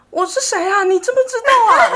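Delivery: high, loud frantic mother pleading, laughing; panicked mom. Retold or imitated. Mom asking her son after surgery who she is, high frantic pleading. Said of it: "然后我妈就说".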